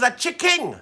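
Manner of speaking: "chicken" said as "chick-king", stressed; deliberate mispronunciation. Shown as a demonstration. Host insisting on the new name, "chicken" pronounced "chicking". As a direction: give "chicken" an -ing ending. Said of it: "I'm calling this one chicken".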